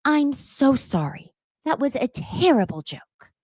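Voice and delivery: sing-song a grudging sorry; Dorit's forced apology. Retold or imitated. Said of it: "Dorit looks at Sutton and she says".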